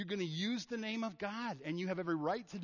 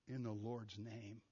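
slow, much softer, lower; solemn blessing; 6 semitones lower, 10 dB quieter, slower than usual. Shown as a demonstration. Blessing someone in God's name, slow and reverent. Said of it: "you're gonna say".

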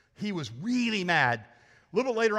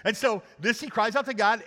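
ordinary talk

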